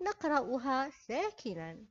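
ordinary talk

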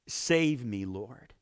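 usual speaking voice

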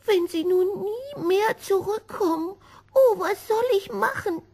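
high, fretful kitten voice near tears; a small cat close to crying, pitched high; anxious young kitten, voice lifted high; worried little cat. Acted. Kitten Gobolino frets alone.

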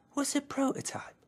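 a puzzled little bear; a shy little bear, puzzled by the hammer; little teddy bear. A narrator's reading. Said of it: "asked Green".

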